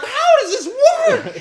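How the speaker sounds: slow, high, laughing mock fanboy; confused fanboy. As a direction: drag it out, baffled and amused. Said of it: "the fanboys are like".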